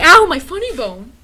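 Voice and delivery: mocking yelp. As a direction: yelp it out, making fun. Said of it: "we kept making fun of it, like".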